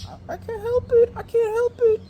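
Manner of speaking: very high, squealed mock plea; mock whiny woman. Girl excusing her noise to her date, mock whining. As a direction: imitate a helpless girl whining in falsetto. Voicing the girl's helpless plea.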